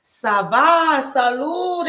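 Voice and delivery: high, bright Ça va, salud; reading viewer's French. Retold or imitated. Host reading a viewer's French greeting aloud, bright. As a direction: sing out the chat greeting. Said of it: "he's saying".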